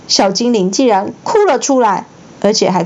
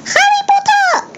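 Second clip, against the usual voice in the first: squeaky house-elf shriek, far higher; a tiny creature squealing with joy; high shrill creature cry. A narrator's reading. Dobby squealing to Harry, very high. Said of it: "那个生物的嗓门又高又尖".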